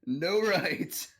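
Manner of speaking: high, badly botched Irish accent; hammed-up Irish that misses the mark; fumbled Irish accent, sounding vaguely Russian; attempted Irish accent. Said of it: "can you do your Irish accent then".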